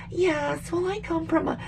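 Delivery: high, soft mock politician voice; Kamala Harris. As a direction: mimic an evasive politician in a high voice. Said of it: "everybody like Kamala Harris".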